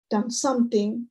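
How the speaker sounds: ordinary talk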